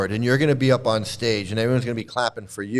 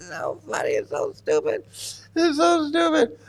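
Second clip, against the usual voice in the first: very high, squeaky talk through laughter; helpless real laughter; 3 dB quieter than usual. Real, not acted. Commentator overcome by silliness, helpless laughter. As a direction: squeeze the words out while cracking up.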